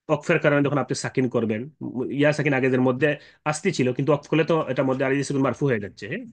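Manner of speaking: ordinary delivery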